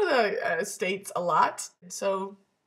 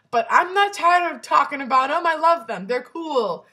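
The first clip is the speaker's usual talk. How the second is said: higher and louder, bursting with delight; gushing excitement; 5 semitones higher, 7 dB louder than usual. Real, not acted. Telling viewers about her new shoes, gushing and loud. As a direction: rave about them like you can't help it.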